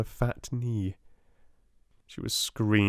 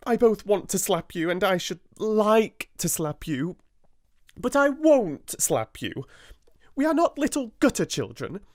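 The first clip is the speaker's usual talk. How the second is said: restrained fire; 12 semitones higher than usual. A narrator's reading. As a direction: bite back the urge to strike. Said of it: "she said with some fire".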